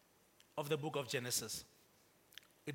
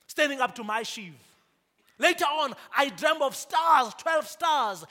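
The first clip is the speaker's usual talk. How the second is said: louder, higher, impassioned sermon delivery; preaching at full force; 8 semitones higher, 9 dB louder than usual. Acted. Pastor rises to full force telling Joseph's story. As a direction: drive the message home with fire.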